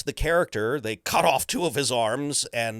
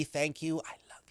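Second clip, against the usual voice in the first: whispered thanks; 4 semitones higher, 7 dB quieter than usual. Real, not acted.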